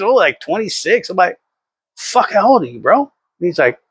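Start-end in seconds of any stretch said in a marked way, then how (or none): 2.0-3.0 retold disbelief. Retold stunned question to young coworker.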